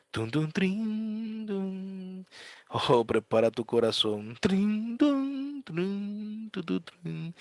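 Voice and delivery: high soft plinks, slow; piano tinkle imitation. Mimicking piano notes.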